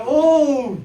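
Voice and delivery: mock thug ogling a couple; thug's catcall. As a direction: play the cheesy hoodlum line. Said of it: "늘 하는 대사죠".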